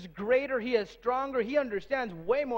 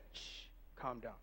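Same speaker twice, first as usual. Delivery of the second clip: much lower, much softer hush; 12 semitones lower, 20 dB quieter than usual. Retold or imitated. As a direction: soothe the storm quietly. Jesus calming the wind and sea.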